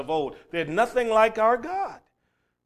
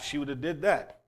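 usual speaking voice

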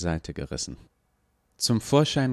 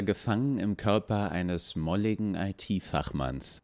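ordinary delivery

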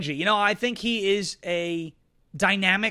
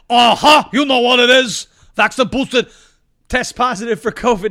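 loud mock old-senator yell; hammed-up Bernie shout, much louder; 3 semitones higher, 9 dB louder than usual. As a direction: bellow it like the Bernie bit.

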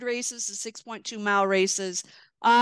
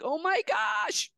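surprised; 4 semitones higher than usual. Retold or imitated. Realizing she races a champion.

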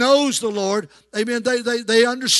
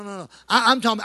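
usual speaking voice

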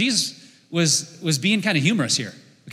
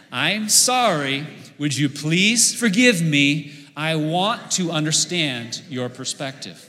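slow, deliberate, word by word with the crowd; slow group recitation; 3 dB louder, slower than usual. Shown as a demonstration. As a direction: lead the congregation through it slowly. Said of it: "Let's practice this together".

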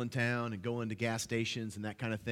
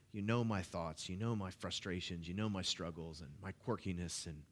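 prayer; 3 semitones lower than usual. Real, not acted. Prays before congregation.

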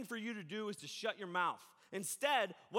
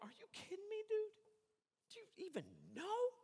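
indignant disbelief; 12 semitones higher, 6 dB quieter than usual. Retold or imitated. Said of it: "I'd be like".

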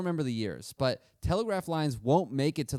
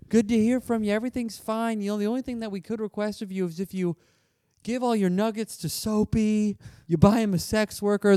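fake cheery letter; 8 semitones higher than usual. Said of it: "being like".

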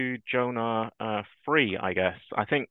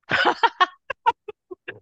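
burst of laughter; 9 dB louder than usual. Real, not acted. Story makes speaker burst out.

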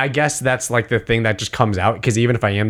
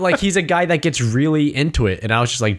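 usual speaking voice

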